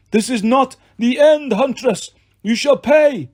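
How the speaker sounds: high, loud wailing threat; monster wailing. A narrator's reading. Wounded manticore wailing a threat at the huntress. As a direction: howl a last vow of revenge.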